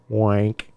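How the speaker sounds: "Wank" with the vowel opened to an A; mispronounced wink. Retold or imitated. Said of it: "Not wank, wink".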